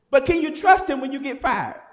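ordinary delivery

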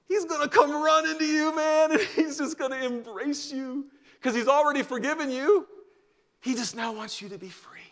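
high, loud, soaring proclamation; preaching at full force. Real, not acted. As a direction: lift the room with joy. Preacher builds to climax.

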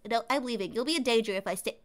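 usual speaking voice

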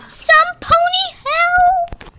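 high, slow cry for help in a put-on voice; pony in danger. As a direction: plead as the captured pony. Pony voiced in murder scene.

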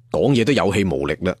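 plain reading voice

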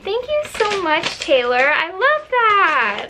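high, drawn-out gushing; touched gushing thanks. Real, not acted. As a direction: gush with real delight, stretching the words. Thanks fan for birthday letter.

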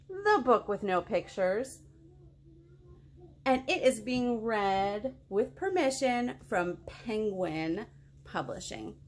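ordinary delivery, nothing marked

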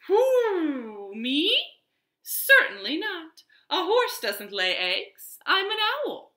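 high, prim owl voice with a hoot. Acted. Owl correcting Cowboy, high and prim. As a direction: sound like a fussy owl. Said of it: "said the owl".

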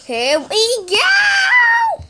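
"Here we go" shouted high, slow and stretched; excited shout. Real, not acted. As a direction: yell it out as the ride launches. Launching, shouting with joy.

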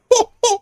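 short high-pitched laugh; high burst of laughter. Real, not acted. Laughing after a miss.

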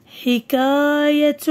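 very slow, stretched vowels; each long vowel held; drawn-out word reading. Shown as a demonstration. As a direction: sound the word out slowly for young pupils. Teacher sounds out word for pupils.

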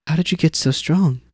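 put-on high, wide-eyed girl's question; a kid in awe of strength; admiring girl. Zane asks the strong worker, high and admiring.

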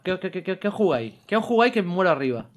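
normal talking voice

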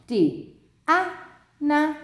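"D, A, na" said one syllable at a time, with pauses; split into syllables. Shown as a demonstration. Teacher counts vowel sounds in her name, "D, A, na" split into syllables. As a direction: break "D, A, na" into three separate beats. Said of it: "How many vowels can you hear in my name?".

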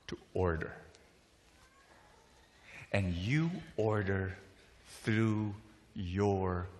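low, soft, heavily paused delivery; hushed preaching. Real, not acted. Preacher applies the restaurant story to the listeners, hushed solemn preaching. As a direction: drop to a solemn hush and let phrases sink in.

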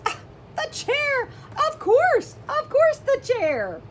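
high, loud delighted cry; excited discovery. Acted. Teacher discovering the answer with the kids, high delighted cry. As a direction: act out the eureka moment.

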